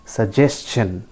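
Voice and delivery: suggestion slowly, as su-JES-chun; careful S-CH in suggestion; correct pronunciation. Shown as a demonstration. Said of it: "listen to me carefully". (suggestion का सही उच्चारण, धीरे से स-जेस-चन)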